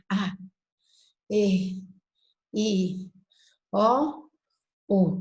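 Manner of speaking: vowels breathed out freely; oral vowels. Shown as a demonstration. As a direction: let the air flow on each vowel. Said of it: "corrente de ar que".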